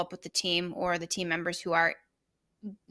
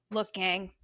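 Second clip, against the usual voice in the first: normal talking voice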